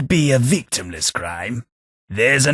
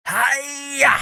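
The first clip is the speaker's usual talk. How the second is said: mock battle cry. Battle cry entering a fight.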